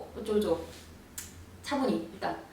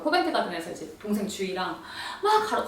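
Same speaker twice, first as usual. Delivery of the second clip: ordinary talk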